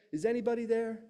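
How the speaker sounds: high-pitched call at talking volume; mock yell, held back; toned-down call for help. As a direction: call out high without really shouting. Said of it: "and he yells out".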